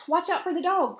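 high, loud warning; alarmed cry. A narrator's reading. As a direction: cry out the danger as a sheep. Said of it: "cried the sheep".

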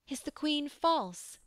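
high, mild wailing cry. A narrator's reading. Pellias wails to Percival, lightly high. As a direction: let the question break into a light wail. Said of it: "he shrank and wailed".

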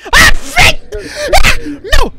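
high, very loud yelps of panic; startled scream. Real, not acted. Player caught by Santa, startled panicked yelling. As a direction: yell out in sudden fright.

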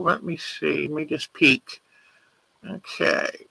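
hushed murmur while looking; soft thinking aloud, almost to herself; absent self-talk. Real, not acted. Peeking for the next picture.

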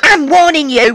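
a high, loud I'm warning you with I'm hit hard; sharp threat. Acted. Escalating pub quarrel threat.

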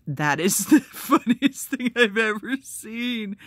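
real laughter breaking the words; laughing. Real, not acted. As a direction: talk through the giggles. Still cracking up over gift.

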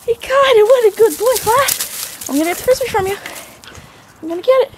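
high squeaky dog-praise voice; squeaky praising a dog. Real, not acted.

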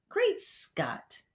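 outraged exclamation. A narrator's reading. Headmistress exclaiming in outrage. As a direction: explode in outrage.

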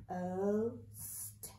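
"O-st-" as separate drawn-out sounds; stretched phonics sounds in "O-st-"; sounding out. Shown as a demonstration. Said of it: "we're gonna sound out".

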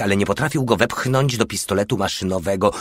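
ordinary delivery, nothing marked

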